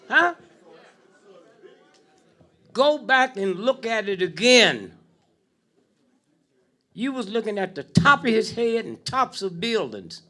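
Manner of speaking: loud, rising sermon build; fiery preaching. Acted. Preaching at full force.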